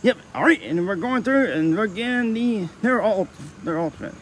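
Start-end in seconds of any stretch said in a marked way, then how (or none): none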